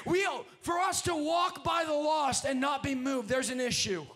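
loud, driving sermon cadence; full-force preaching. Real, not acted. Preacher urging congregation to carry God's vision, full-force preaching. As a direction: preach it hard, stretching the phrase endings.

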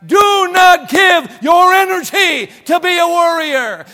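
thundering pulpit command; preaching shout. Real, not acted. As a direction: drive the warning home at full voice. Preacher exhorts the congregation.

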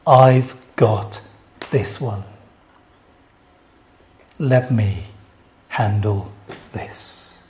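low, measured, fading to very soft; quiet reassurance. Acted. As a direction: take charge calmly, word by word. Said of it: "He's saying to the world".

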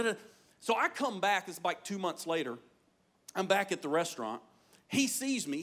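ordinary delivery

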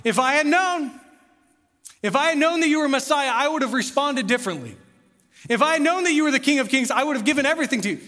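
high, loud acted protest; pleading excuse. Sinner to Messiah at life's end, loud pleading protest. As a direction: preach it as a desperate after-the-fact plea. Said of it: "you cannot say at the end of your life".

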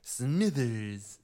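drawn-out cartoon villain calling his assistant; Mister Burns. Host showing off the cartoon boss impression, Mister Burns voice. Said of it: "I'd be really good at Mister Burns".

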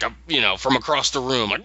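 ordinary delivery

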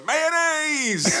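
high-pitched drawn-out cry, comic mimicry; imitating Sam. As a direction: squeal the word out in a put-on voice. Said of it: "Sam's just watching going".

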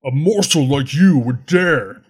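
loom over them as a dragon. Acted. GM voicing dragon threatening player.

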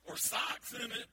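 ordinary talk

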